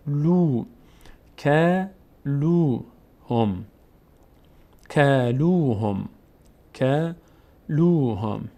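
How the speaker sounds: کلوهم slowly, with "loo" drawn out; long vowel. Shown as a demonstration. Teacher showing lam before waw, long vowel. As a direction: hold the oo in لو of کلوهم.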